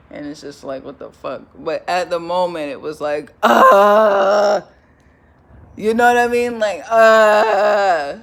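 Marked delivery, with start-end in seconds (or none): 3.4-4.6 loud mock moan of embarrassment; embarrassed moan. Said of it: "moan in uncomfortability and embarrassment". 6.9-8.2 loud mock moan of embarrassment; embarrassed moan. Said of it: "moan in uncomfortability and embarrassment".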